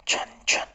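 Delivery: whispered "chhan, chhan"; breath-only imitation of a jingle; imitated jingle. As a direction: mimic a faint chiming noise. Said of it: "इस तरीके की आवाज़ आ रही है".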